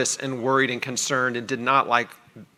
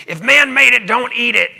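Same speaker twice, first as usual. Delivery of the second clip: high, loud preachy health guru; loud, high mock Jack LaLanne decree; feisty health guru; 8 semitones higher, 8 dB louder than usual. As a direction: proclaim it like a fired-up fitness evangelist. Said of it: "and he's, like, real feisty".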